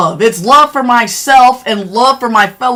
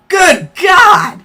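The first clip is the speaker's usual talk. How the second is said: higher, shouted exclamation; exasperated outburst; 5 semitones higher than usual. Real, not acted. Streamer fed up with a viewer's claim, shouting. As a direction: cry out in disbelief.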